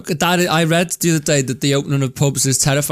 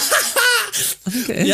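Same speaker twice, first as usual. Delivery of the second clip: laughing; 3 dB quieter, much slower than usual. Real, not acted. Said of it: "laughing through words".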